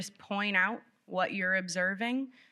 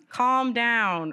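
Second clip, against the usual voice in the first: loud, higher "Calm down", falling hard; stern command; 4 semitones higher, 7 dB louder than usual. Retold or imitated. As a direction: bark the old parent phrase. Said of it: "old phrases".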